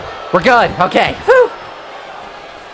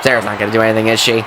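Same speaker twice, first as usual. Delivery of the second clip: ordinary talk, nothing marked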